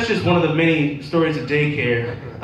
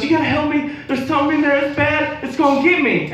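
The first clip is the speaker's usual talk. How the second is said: put-on much higher, urgent child's voice; frightened little kid; 12 semitones higher than usual. Kid warning his teacher about the toilet, high and scared. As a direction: warn him like a terrified small boy. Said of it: "he goes".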